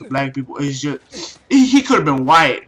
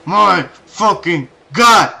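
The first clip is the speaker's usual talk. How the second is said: slow, louder drawn-out exasperation; exasperated groan; 4 semitones higher, 6 dB louder, much slower than usual. Retold or imitated. Son groans at the satellite man again, slow and loud. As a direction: stretch it out, completely fed up. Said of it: "I'm like".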